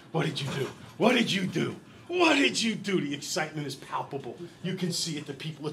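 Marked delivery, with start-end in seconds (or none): 0.1-3.0 building disbelief. Retold or imitated. Family stunned by their new house.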